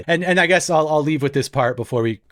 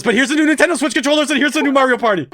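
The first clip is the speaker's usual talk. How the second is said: much higher, louder and fast, overselling the gift; mock overexcited pitch; 10 semitones higher, 4 dB louder, faster than usual. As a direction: sell it like a desperate game-show host. Parents overselling gifts.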